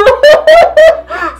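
high loud shrieking laugh. Real, not acted. Vlogger laughing at collapsed stage, shrieking laugh. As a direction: explode into laughter.

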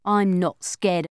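plain reading voice

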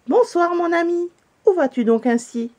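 hailing a friend from up high; calling out. A narrator's reading. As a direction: cry out a high, carrying hello. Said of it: "s'écria".

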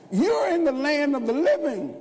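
high, loud pulpit call; exultant preacher's question; rousing shout. Real, not acted. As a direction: lift the crowd with it.

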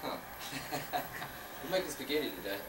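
ordinary talk

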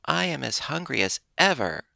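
put-on a tired child grumbling; mild petulant whine; fretful. A narrator's reading. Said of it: "said in a fretful tone".

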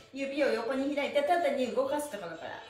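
normal talking voice

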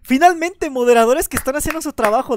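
loud, high cheering; excited praise. Real, not acted. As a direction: hail the mods.

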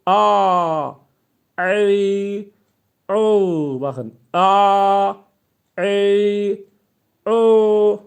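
pressed throat sounds for the letter ayin; throaty ayin. Shown as a demonstration. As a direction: strain the throat, open the mouth and push out A, i, u. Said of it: "Zorlayın boğazınızdan. Ses çıkarmaya çalışın".

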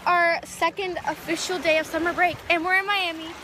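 loud excited. Real, not acted. Shouting over beach wind.

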